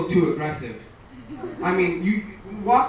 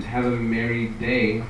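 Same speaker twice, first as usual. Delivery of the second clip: slow repeat; 6 semitones lower, slower than usual. Retold or imitated. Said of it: "I said".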